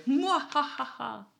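slow, drawn-out villain laugh; slow evil cackle. Pretend. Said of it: "[name], you sound angry".

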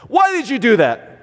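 high, pointed "Why did you do that?" that scolds; scolding parent's "Why did you do that?"; accusing question. Retold or imitated. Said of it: "You're dumb, you're an idiot".

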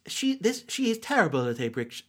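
normal talking voice

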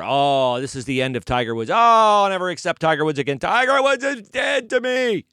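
loud, mocking fan swearing off a star; mock outraged fans. Host mocks fans swearing off Tiger, loud mocking sneer. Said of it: "people were".

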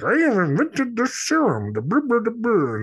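squeaky stammering scientist; Julius Kelp voice. Retold or imitated. Host imitates Dice doing the nutty professor for the guest. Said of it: "go up on stage as Julius Kelp".

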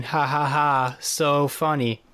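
flat mock laugh, then slow dry "So funny"; deadpan sarcasm; sarcastic fake laugh. Mocking the show's pun.

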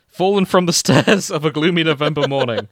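reading through giggles; can't keep a straight face mid-sentence; laughing. Real, not acted. Host rereading the misread line to cohosts, laughing through it.

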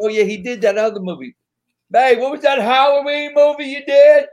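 high, loud country wife calling out; wife asking her husband about his movie in a country accent. Retold or imitated. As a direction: holler the question in a Southern country accent. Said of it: "She got a real heavy country accent".

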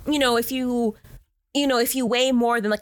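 ordinary delivery

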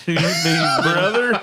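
loud, slow echo breaking into laughter; high, laughing mock Southern echo; laughing mock repeat. Repeats the Alabama joke, laughing.

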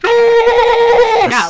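long high held yeah; excited squeal. Real, not acted. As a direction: stretch it out in a shriek. Wild excited yell.

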